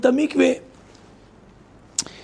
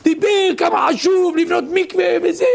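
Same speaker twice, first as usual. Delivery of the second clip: higher-pitched mock orator's delivery; imitating an impassioned public speech; addressing a crowd; 6 semitones higher than usual. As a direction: preach it as if to thousands. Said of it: "דיבר כאילו יש אלפיים איש".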